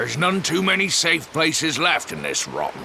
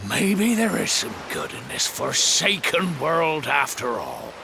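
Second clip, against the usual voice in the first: ordinary talk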